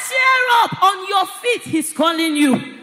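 loud, slow call of encouragement; rousing shout. Acted. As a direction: shout someone up onto their feet. Acting the crowd rousing Bartimaeus.

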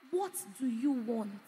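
low, hushed and deliberate; quiet, probing preacher's question; soft, searching question. Real, not acted. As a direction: drop right down and ask it gently. Softly posing the key question.

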